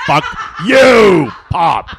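high, loud laughing mock shout at Pop. Son yelling at his dad in the theater, hammed-up shout. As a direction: yell it through a laugh.

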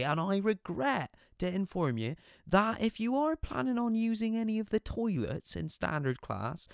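high, far-off mock PA announcement; sarcastic train guard over the tannoy; sarcastic train announcer. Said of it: "use the P A system to practice his stand-up comedy".

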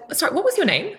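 lifted, cheery ask from the stage; bright friendly question. Retold or imitated. Coach singling out Felicity in the front row, high bright ask. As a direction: perk up and ask her name brightly.

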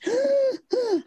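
high mock gasp for air; winded gasp. Retelling the fall to friends, gasping. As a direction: gasp as if fighting for breath.

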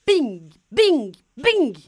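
bing claqués fort et aigus; coups de marteau. Acted. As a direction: frappe chaque bing comme un coup. Coups de marteau sur la porte.

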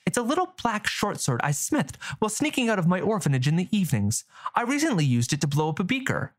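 put-on girl bragging, fast; young girl voice. Girl character boasting.

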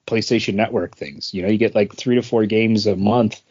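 ordinary talk

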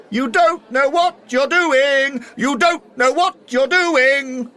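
booming high yell, slow and drawn out; loud voice. Acted. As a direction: bellow it across the room in mockery. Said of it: "he heard a loud voice say".

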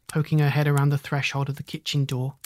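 ordinary delivery, nothing marked

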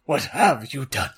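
put-on indignant old headmaster; hammed-up stern outcry; Dumbledore, angry. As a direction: demand it in a theatrical character voice. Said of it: "Dumbledore walked in angrily".

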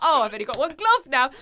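fast, laughing fret; mum voice. Retold or imitated. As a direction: rush it out, half laughing. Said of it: "She's like".

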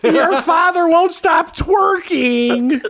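slow, high, laughing complaint; high laughing mom voice. Pretend.

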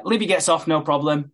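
ordinary talk

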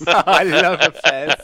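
high laughing delight. Real, not acted. GM cracking up at a player's fez. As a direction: squeal with laughter over the fez.

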